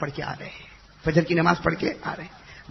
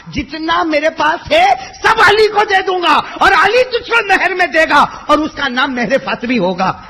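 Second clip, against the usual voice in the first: much higher, loud preaching cry; impassioned shout; 10 semitones higher, 11 dB louder than usual. Acted. Prophet rising tells Fatima he will give all, shouting. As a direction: cry it out as you rise. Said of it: "کھڑے ہوتے ہوتے کہا".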